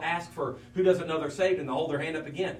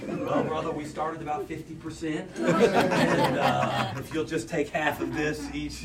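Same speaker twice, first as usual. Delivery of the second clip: jokey answer breaking into laughter; laughing joke; 4 dB louder, slower than usual. Pretend. Pastor jokingly telling the doctor to tithe half, laughing. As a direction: tease him, laughing through it.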